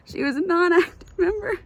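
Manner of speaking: high, loud, sobbing read; reading while crying. Real, not acted. Reader crying as she reads the epilogue aloud, high and loud. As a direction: choke the lines out through tears.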